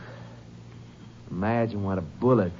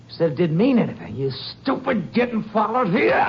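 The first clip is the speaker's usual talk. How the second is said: angry scolding; 9 semitones higher, 5 dB louder than usual. Acted. Rex berates JoJo.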